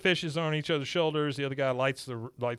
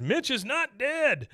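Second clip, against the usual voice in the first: much higher, slow insistent voice; imitating a pleading owner; 12 semitones higher, slower than usual. Owner refuses to accept his pig is gone, high and slow. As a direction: insist he's alive, desperately.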